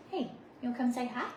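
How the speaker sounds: ordinary talk, nothing marked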